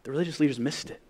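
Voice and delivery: normal talking voice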